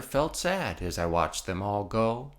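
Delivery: ordinary delivery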